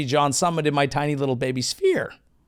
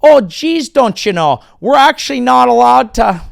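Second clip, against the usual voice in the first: higher and louder hammed-up Minnesotan; chirpy Upper Midwest "oh geez" voice; Minnesota accent; 9 semitones higher, 9 dB louder than usual. Comic mock Minnesotan explaining politely.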